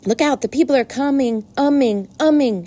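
high, excited goose shout; shouted warning. A narrator's reading. Gander warns the barnyard that people are coming, shouted. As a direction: call out the alarm with repeating echoes. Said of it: "shouted the gander".

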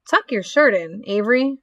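ordinary delivery

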